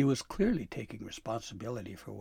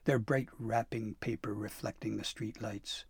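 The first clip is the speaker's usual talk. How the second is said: plain reading voice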